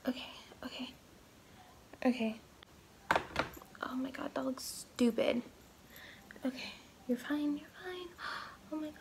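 anxious whispered self-soothing; hyperventilating. Real, not acted. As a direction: panicky breaths, calming yourself. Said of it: "anxiety attack".

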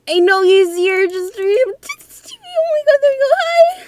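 shrieking as the monster arrives; terrified shriek. Real, not acted. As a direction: scream in real terror.

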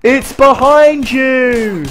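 slow, high, loud panto shout; panto crowd callback. Pretend. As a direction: call out like a pantomime audience. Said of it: "It's so panto".

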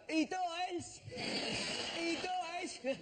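put-on high, slurred drunk heckle; drunk passerby shouting. Drunk passerby bellowing a spoiler at the queue. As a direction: call out like a drunk spoiling the ending. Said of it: "someone who's drunk would just wander past and go".

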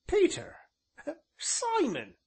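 very high, loud ancient with a chuckle; high-pitched rustic elder, tickled; delighted old man. A narrator's reading. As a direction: cry out each name gleefully. Voices the ancient.

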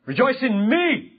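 high, slow call with a rising end; mock self-exalting. Preacher pretends to tell sons, wife or congregation, self-exalting. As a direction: pretend to demand adoration, drawn out and high. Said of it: "If I were to say that".

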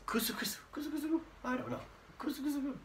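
high whispered giggle kusu kusu; mock giggle. Shown as a demonstration. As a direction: titter it lightly, up high. Demonstrating giggle sound.

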